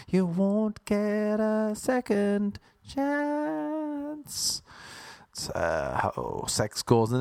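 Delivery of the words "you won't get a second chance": mock ominous warning. Repeated verdict, drawn out.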